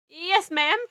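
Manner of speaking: high, loud submissive squeal; mewling squeak. A narrator's reading. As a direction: squeak obediently to the scolder. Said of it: "my mewling squeak".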